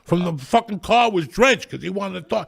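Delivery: loud, high-pitched exasperated rant; comic indignation. Retold or imitated. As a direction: complain loudly about the absurd soaking. Indignant telling of getting soaked.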